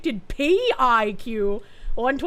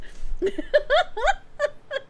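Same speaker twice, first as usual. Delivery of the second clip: high laughter; 13 semitones higher than usual. Real, not acted. Laughing at own joke.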